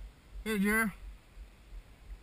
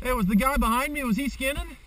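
raised voice calling across the snow; loud, higher call to a friend; 6 semitones higher, 4 dB louder than usual. Real, not acted. Calling to a distant skier.

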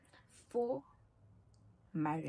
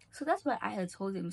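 usual speaking voice

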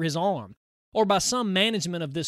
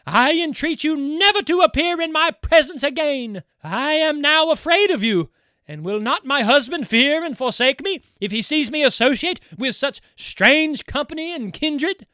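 high, loud pleading voice; frightened young bride; 8 semitones higher, 8 dB louder than usual. Acted. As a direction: beg him away in alarm. Undine begs him to leave.

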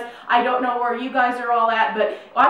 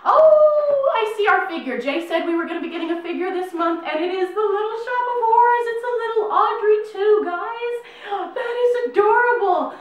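excited squeal; 10 semitones higher, 3 dB louder than usual. Real, not acted. Finding the figure, delighted.

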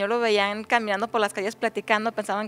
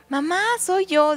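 put-on high-pitched, slow little kid; tiny child voice; 9 semitones higher, 4 dB louder, slower than usual. Chickpea boy reveals himself to his mother, tiny high voice. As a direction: announce yourself in a little child's voice. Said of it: "se escuchó una vocecita".

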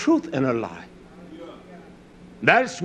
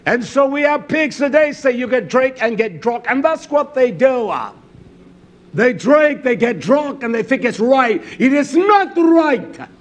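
loud, high sermon delivery, hard emphasis on key phrases; fiery preaching; 6 semitones higher, 4 dB louder than usual. Real, not acted. Preacher warning the congregation about drink, fiery preaching. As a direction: preach it with force, punching phrase endings.

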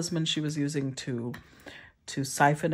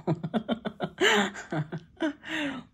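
high laugh at herself; amused laugh; 8 semitones higher than usual. Real, not acted. Laughs at own accent.

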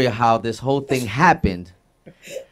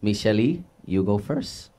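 put-on Spanish accent; 3 semitones lower than usual. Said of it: "how to say it in a Spanish accent".

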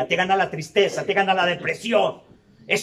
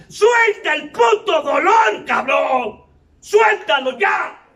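shouted preaching; 4 semitones higher than usual. Acted. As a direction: yell at him to let the pain out. Confronting the newcomer, shouting.

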